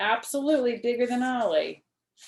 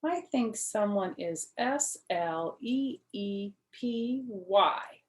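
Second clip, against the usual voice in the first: slow, letter by letter; mother spelling a word over a child's head, slowly; much slower than usual. Acted. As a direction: spell each letter out to the other parent. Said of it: "she spelled".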